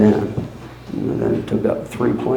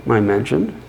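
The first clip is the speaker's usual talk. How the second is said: ordinary delivery, nothing marked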